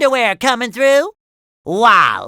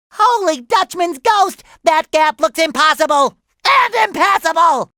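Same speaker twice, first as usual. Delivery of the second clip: alarmed exclaim; 7 semitones higher than usual. Acted. SpongeBob panics at huge gap.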